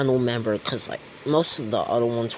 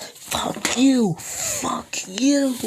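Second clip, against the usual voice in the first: very high, louder spiteful yell; angry screeched curse; 13 semitones higher, 4 dB louder, much slower than usual. Acted. Cornered gang leader screams at Deku. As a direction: spit it at him.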